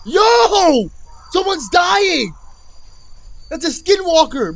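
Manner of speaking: loud, high-pitched cry of alarm; alarmed shouting. Real, not acted. Streamer reacting to screams in a video, alarmed shouting. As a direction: yell out in shock at what you hear.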